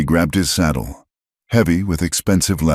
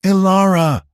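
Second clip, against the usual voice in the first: high, loud scream of her name; 4 dB louder than usual. A narrator's reading. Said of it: "Silas screamed".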